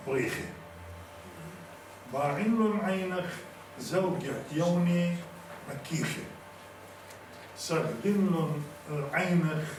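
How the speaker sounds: measured poetic delivery with drawn-out words; poem recitation. Acted. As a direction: declaim each line slowly, pausing between phrases.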